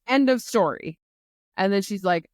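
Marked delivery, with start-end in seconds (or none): 0.1-1.0 louder, higher, emphatic sign-off; exasperated outburst. Retold or imitated. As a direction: shut the argument down, acting her out. Retold Kat closing the argument.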